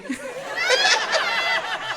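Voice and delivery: high real laughter; laughing. Real, not acted. Teller laughs again.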